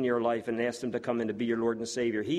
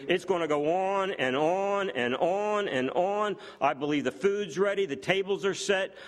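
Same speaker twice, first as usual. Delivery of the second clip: preaching at full force; 6 semitones higher than usual. Acted. Preacher stirring the congregation about the wedding feast, rousing full-force preaching. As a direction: lift the church with rising fervour.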